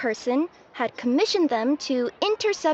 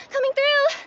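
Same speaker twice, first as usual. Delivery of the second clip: calling out; 10 semitones higher, 3 dB louder than usual. Acted. Repeated call.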